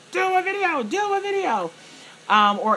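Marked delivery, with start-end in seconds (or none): 0.1-0.8 much higher, pleading mock fan voice; begging fans. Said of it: "my Facebook fam who'd be like". 0.8-1.7 much higher, pleading mock fan voice, repeating the plea; begging fans. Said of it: "my Facebook fam who'd be like".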